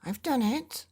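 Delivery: loud, high triumphant holler. Acted. Father yelling up at the creature in triumph, loud holler. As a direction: shout it out like you've won. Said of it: "hollered Father".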